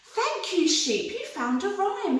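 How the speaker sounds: delighted rabbit. Acted. Rabbit thanking the sheep, high and delighted. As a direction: thank her, thrilled. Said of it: "he said".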